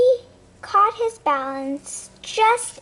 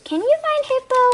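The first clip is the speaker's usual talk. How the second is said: playful question; 11 semitones higher, faster than usual. Real, not acted. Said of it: "I have a question for you".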